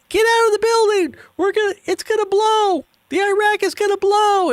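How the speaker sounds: very high, loud panicked shrieks; mock panicked shouting. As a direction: scream warnings like a fleeing crowd. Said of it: "everybody's like".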